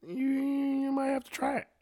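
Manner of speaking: high-pitched reluctant temptation; tempted hedging. Acted. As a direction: stretch the first word way up high while wavering. Weighing the absurd offer.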